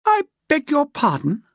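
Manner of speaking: high, tight and offended; a furious solicitor, stiffly polite; stiff with anger. A narrator's reading. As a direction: bite back the anger politely. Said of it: "through lips stiff with anger".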